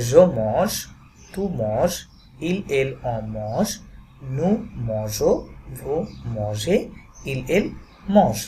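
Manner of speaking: each form of "mange" drawn out slowly; slow French pronunciation demo. Shown as a demonstration. Teacher showing students how mange sounds. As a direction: read the conjugation slowly for learners to copy. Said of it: "তাহলে উচ্চারণটা কি হবে?".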